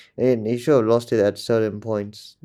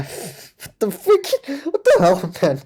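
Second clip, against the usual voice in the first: high, stretched out in shock; can't believe what he just heard; shocked disbelief; 9 semitones higher than usual. Real, not acted. Shocked reaction to wrestling promo.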